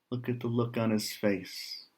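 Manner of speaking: usual speaking voice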